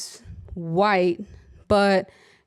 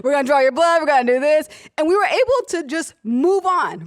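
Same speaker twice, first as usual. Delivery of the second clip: normal talking voice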